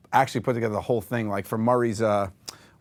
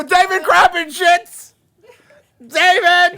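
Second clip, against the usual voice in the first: very high, loud, drawn-out holler; mock-outraged high shout; hammed-up yell; 11 dB louder, much slower than usual. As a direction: shriek it as a running gag. Comic yelled name, callback.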